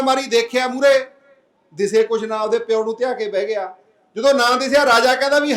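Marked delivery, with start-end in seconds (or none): none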